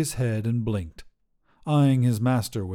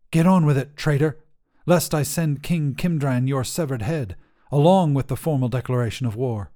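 louder, threatening knight's voice; stern Kingsguard; 4 semitones higher, 4 dB louder than usual. Acted. Kingsguard threatens the wizard, loud and commanding. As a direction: lay down the threat with a sword at his back.

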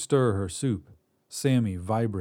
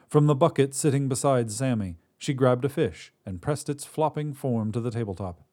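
plain reading voice

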